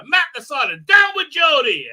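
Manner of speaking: loud, high rallying cry; shouted call. Real, not acted. Streamer reading a chat slogan, loud and high.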